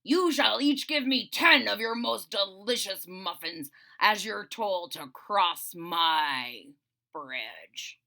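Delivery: slow, grand dragon command; rumbling dragon decree. Acted. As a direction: lay down the toll like a lord. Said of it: "he rumbled".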